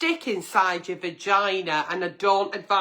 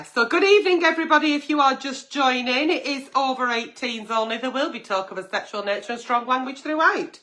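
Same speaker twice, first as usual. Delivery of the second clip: upbeat host's welcome, higher and quick; show opener; 6 semitones higher than usual. Acted. Host greeting the late-night audience, upbeat announcer. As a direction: greet the viewers like a live host.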